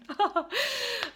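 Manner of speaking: high teary laugh-sob; tearful laugh. Real, not acted. Recalling the homeless mother, a high teary laugh. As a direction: let a crying laugh escape. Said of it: "Oh my God, I'm crying again".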